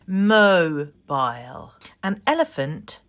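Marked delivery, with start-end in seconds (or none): 0.0-1.7 "mobile" drawn out very slowly, both syllables clear; slow two-beat "mobile" for pupils; syllables stretched. Shown as a demonstration. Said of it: "So when we say it".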